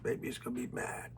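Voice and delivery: whispered worry, barely voiced; a frightened woman whispering to herself; fearful whisper. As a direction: hide the fear in a whisper. Woman's fearful inner thought.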